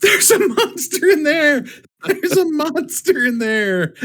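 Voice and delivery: high-pitched mock alarm yell. Hiding beside the building, yelling out about a monster, high mock yell. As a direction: yell a warning in a high falsetto. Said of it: "I'm gonna yell out".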